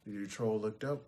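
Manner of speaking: ordinary delivery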